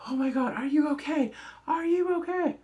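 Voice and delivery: mock panicked crew; alarmed. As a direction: rush in worried after a stunt. Crew panics after window stunt.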